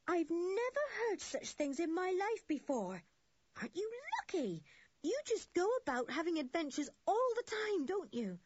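a hint of an awestruck schoolboy; lightly voiced excited boy. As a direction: gush like an amazed boy.

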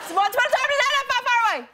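squeaky storybook voice. Pretend. Fairy tale guess, squeaky fast.

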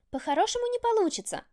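gleeful, high menace; relishing threat. A narrator's reading. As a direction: savour the threat. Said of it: "с удовольствием произнесла". (с удовольствием, угрожающе)

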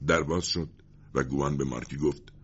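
ordinary delivery, nothing marked

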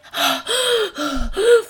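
exaggerated loud, high huffing; out-of-breath gasping. Recalling being unfit on the stairs, mock panting. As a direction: gasp as if winded by the stairs. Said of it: "every time I went up the stairs".

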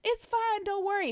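high, light comforting voice; reassuring idol. Retold or imitated. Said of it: "cracking jokes".

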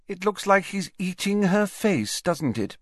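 cool, detached and dry; dispassionate. A narrator's reading. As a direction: say it without caring. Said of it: "said Ginny dispassionately".

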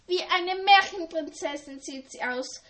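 dreamy, thrilled admiration; gushing enthusiastically. A narrator's reading. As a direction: gush over her like a fairy-tale princess. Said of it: "schwärmte Ellie begeistert".

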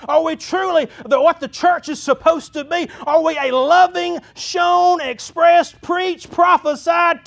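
high, loud rhetorical questions, building to a shout; full-force preaching. Real, not acted. Pastor challenging church on who they are, full-force preaching. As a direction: fire each question at the congregation.

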